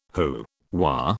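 low, soft, sleepy mumble; groggy. A narrator's reading. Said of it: "I groggily replied".

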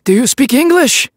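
high, loud, distinct voice yelling up; yelled question. Acted. Trapped man yelling up to the French officer, high loud question. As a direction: shout it back hopefully.

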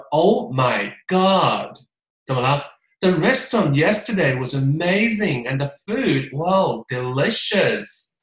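slow, gushing livestream seller; 像李佳琦带货一样夸张赞叹; Li Jiaqi livestream style. Shown as a demonstration. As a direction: rave like a hyped product host. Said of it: "李佳琦上身啊".

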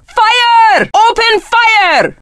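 high, urgent firing order; shouted command. Acted. Warrior ordering allies to open fire, high shouted command. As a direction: call out the order, pitched high.

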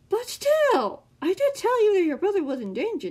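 high, loud, theatrical villain; taunting villain. A narrator's reading. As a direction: needle him in a high, loud put-on voice. Villain needling Sam in put-on voice.

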